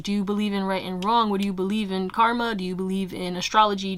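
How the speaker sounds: ordinary delivery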